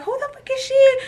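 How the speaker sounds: very high-pitched indignant squeal; imitated outraged homegirls. Other girl's friends rushing over, high mock outrage. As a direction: squeal it as a shocked challenge.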